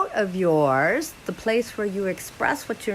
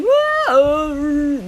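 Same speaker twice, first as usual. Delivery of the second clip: louder high whoa; release whoa; 4 semitones higher, 5 dB louder than usual. Shown as a demonstration. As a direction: let it out on whoa. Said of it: "It might sound like".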